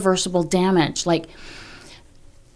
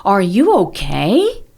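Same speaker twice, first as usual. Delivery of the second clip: slow, louder, caring question; gentle check-in; 5 dB louder, slower than usual. Shown as a demonstration. Parent walking in on screaming child, caring slow question. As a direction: ask it slowly and kindly. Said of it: "by saying".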